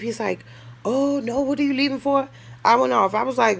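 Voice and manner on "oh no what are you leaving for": higher, whiny plea to stay; imitating pleading trick. Trick whining at her to stay. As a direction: mimic him begging her not to go.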